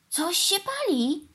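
high, drawn-out cry of warning; alarmed cry. A narrator's reading. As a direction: cry the alarm, stretching the words. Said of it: "krzyknął Norman".

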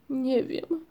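high, torn and helpless; despairing. A narrator's reading. Ryjek torn between belt and globe, despairing. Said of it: "powiedział Ryjek z rozpaczą".

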